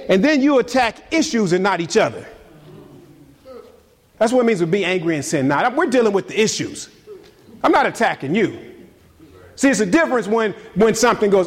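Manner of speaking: ordinary talk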